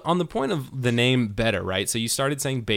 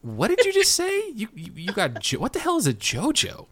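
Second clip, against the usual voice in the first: high, incredulous squeal of disbelief; a double take, voice jumping way up; baffled disbelief. Retold or imitated. Host hearing coworkers say JoJo's, stunned disbelief. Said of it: "I'm like".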